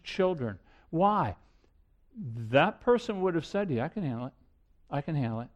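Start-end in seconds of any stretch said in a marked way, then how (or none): 3.8-5.5 low, quiet brush-off; dismissive reassurance. Acted. Preacher voices an addict in denial, low dismissive reassurance. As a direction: play down the problem in a low, soft voice.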